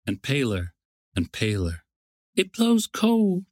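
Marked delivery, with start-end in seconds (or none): 2.3-3.5 high, slow storybook queen's voice; fading queen. A narrator's reading. Queen of the year feeling the cold, plaintive. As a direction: draw it out high, as the paling queen. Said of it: "she said, more and more silent, and paler".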